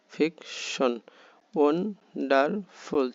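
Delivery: ফিকশন, ওয়ান্ডারফুল with gaps between syllables; split into syllables. Shown as a demonstration. Teacher breaking ফিকশন and ওয়ান্ডারফুল into parts for learners. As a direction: separate each part of ফিকশন and ওয়ান্ডারফুল. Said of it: "তিন ভাগে".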